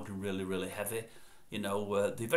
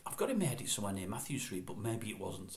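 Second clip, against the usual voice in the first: normal talking voice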